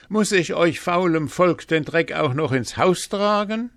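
high, loud indignant scolding. A narrator's reading. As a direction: berate a lazy household at full voice. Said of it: "Und er schalt".

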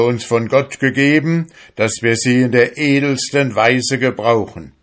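put-on old priest thundering against the sea folk; priest's outcry. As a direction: rebuke him like a shocked priest. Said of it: "rief aus".

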